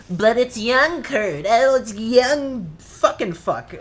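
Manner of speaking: high, slow mocking whine; mocking the filmmakers. Mocking the movie's excuse in a high slow whine. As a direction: whine it like a bored studio exec. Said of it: "they're like".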